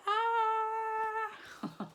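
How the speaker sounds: high loud mock scream; family fleeing the bear, screaming. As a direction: squeal in fake panic. Characters fleeing in panic.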